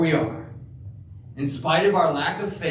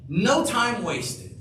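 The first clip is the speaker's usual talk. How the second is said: ordinary talk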